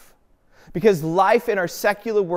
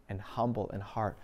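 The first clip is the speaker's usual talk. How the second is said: much softer and lower, "and humble in heart" almost breathed out; hushed; 8 semitones lower, 13 dB quieter than usual. Acted. Preacher quietly drawing the congregation inward, hushed. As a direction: let "and humble in heart" fall to a hush.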